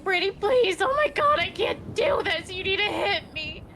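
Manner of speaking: high, loud hammed-up begging; mocking a friend frantically begging to be hit; frantic pleading. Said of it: "She's like".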